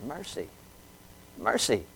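very soft, low repetition; hushed emphasis. Real, not acted. Preacher telling what Jesus manifested, hushed emphasis. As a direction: say it almost under your breath, with pauses. Preacher drops voice, repeats key word.

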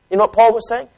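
normal talking voice

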